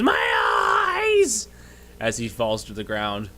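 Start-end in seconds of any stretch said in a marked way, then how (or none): 0.0-1.5 high, loud, drawn-out scream of agony; hammed-up berserker shrieking in pain. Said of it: "just screams".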